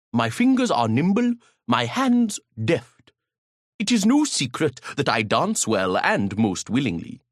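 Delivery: plain reading voice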